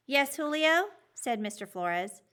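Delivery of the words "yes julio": high, loud call on a student; teacher calling on pupil. A narrator's reading. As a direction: call on him from the front.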